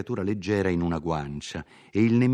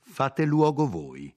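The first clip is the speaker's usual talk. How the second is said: higher, slow defiant throw-back of the order; slow, raised, defiant reply to the nobleman; defiant retort; 8 semitones higher, slower than usual. Acted. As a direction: hurl the insult back at him, slowly. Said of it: "rispose Lodovico".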